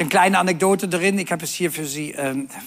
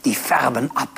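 put-on embarrassed young man; 6 semitones lower than usual.

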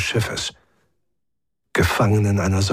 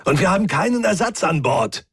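high-pitched exasperated protest; complain, harried and annoyed; frustrated, raised voice; hassled and pissed off; 8 semitones higher than usual. A narrator's reading. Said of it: "zu gleichen Teilen gehetzt wie angepisst".

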